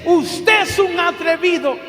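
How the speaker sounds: slow, loud, indignant shout; a woman shouting at the preacher who offended her. Retold or imitated. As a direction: yell it at him like an insult. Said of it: "ella me grita y me dice".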